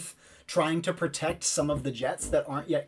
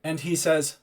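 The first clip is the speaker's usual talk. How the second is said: ordinary talk, nothing marked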